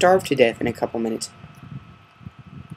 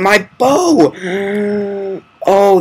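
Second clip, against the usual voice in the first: excited; 7 semitones higher, 7 dB louder, much slower than usual. Real, not acted. Finds his lost bow.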